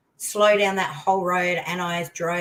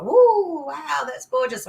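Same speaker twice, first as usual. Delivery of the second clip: awed delight; 9 semitones higher, 6 dB louder, slower than usual. Retold or imitated. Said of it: "I'm just like".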